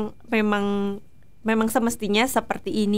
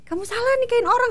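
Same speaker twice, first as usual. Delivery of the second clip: high, fast playful complaint pitched way up; teasing whine; 13 semitones higher, 4 dB louder, faster than usual. Retold or imitated. Wife teasing husband about her tank tops, high playful whine. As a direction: tease the husband in a high squeal. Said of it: "aku tuh suka bilang gini".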